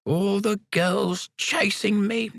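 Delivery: put-on high, slow old man boast. A narrator's reading. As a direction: echo the old man's brag. Sam's line echoed again.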